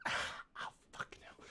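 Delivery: hushed curse under the breath; breathless whispered swear; pained whisper. Real, not acted. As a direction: whisper it through the sting. Pain after self-spanking.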